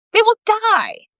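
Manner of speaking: high, loud dramatic doom. A narrator's reading. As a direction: declare doom, pitched high. Isis dramatic.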